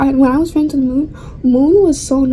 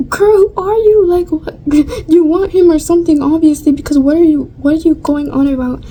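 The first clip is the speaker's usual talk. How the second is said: higher-pitched, retold incredulous clapback; scoffing retort with a raised pitch; incredulous confrontation; 3 semitones higher than usual. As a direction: call her out in mock disbelief. Retold confrontation with rude girl.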